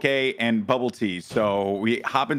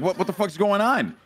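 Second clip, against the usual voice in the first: higher-pitched retold disbelief; baffled alarm; 7 semitones higher than usual. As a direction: act out bewildered exasperation, voice rising. Retold alarm after operator hung up.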